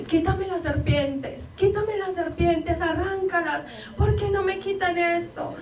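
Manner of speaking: high, drawn-out pleading; acting out a terrified hallucinating daughter; retold panicked begging. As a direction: plead frantically to be rid of something, pitch climbing.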